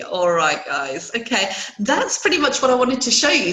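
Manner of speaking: ordinary talk, nothing marked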